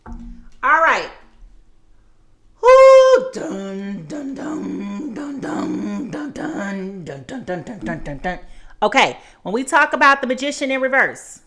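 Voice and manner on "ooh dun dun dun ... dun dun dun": dramatic dun dun dun. Pretend. Dramatic sound effect.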